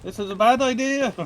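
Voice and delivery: put-on high, loud Irish accent; a jokey Irish warning. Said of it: "this is an Irish accent".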